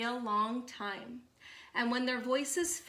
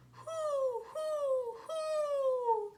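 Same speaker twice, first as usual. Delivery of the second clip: high hooting owl call; long, high, hollow owl hoots; much slower than usual. Acted. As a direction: hoot like a great horned owl. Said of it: "the sound of a great horned owl".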